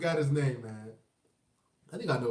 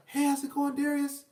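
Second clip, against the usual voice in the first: higher, raspy meek greeting; imitated raspy timid voice; 5 semitones higher than usual. Timid school dad greeting another father, raspy meek impression. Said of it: "this how we talk".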